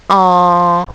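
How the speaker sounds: en as one long, loud nasal vowel; isolated sound. Shown as a demonstration. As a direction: hold the en steadily. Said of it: "et le son".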